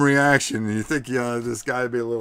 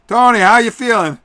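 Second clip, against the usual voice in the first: loud interviewer; 9 semitones higher, 12 dB louder than usual. Acted. Ringside interviewer calls to winner.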